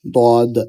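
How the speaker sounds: "দদ" held, tongue edge against the upper molars; emphatic Arabic Dad in a lone, drawn "দদ"; tongue-side Dad. Shown as a demonstration. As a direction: demonstrate a heavy "দদ" with the tongue's side on the back teeth. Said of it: "জিহ্বার এই সাইডটা চোয়ালের সাথে লাগিয়ে উচ্চারণ".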